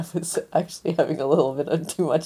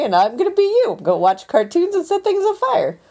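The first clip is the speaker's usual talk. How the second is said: higher, pitched-up mock co-host voice; co-host swapping roles, Freaky Friday style, mock voice pitched up; playing co-host; 12 semitones higher, 3 dB louder than usual. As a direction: tease by taking on her role, voice raised. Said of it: "You get to be me".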